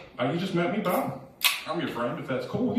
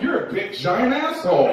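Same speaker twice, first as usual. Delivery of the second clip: higher, louder pretend abuse; mock insult; 8 semitones higher, 5 dB louder than usual. Comic showing an insult before excusing it, mock-loud. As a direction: blurt a joking insult at full volume.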